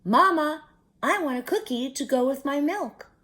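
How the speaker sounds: put-on a happy young calf begging sweetly. A narrator's reading. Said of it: "mooed happily".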